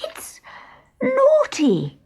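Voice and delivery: put-on high, halting child's scold; a high tearful little protest; flustered girl. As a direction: stammer the scolding. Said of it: "said Martha".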